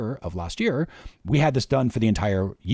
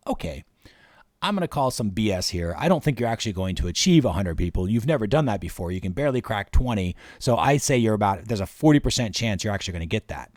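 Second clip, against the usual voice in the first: ordinary delivery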